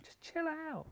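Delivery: high, soft, drawn-out chill; put-on high chill voice. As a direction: sing it like a calm guru. Mock relaxed voice.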